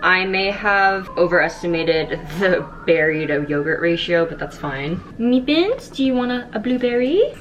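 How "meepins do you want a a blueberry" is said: pet talk voice. Real, not acted. Talking to her dog.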